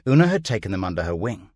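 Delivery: plain reading voice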